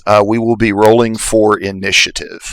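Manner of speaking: ordinary talk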